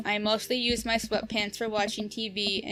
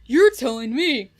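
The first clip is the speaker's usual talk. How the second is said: louder lightly voiced jock, punching You're; dumb bro jock voice; 7 dB louder than usual. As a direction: hit You're like a loud lazy jock. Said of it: "the voice stuff in a while".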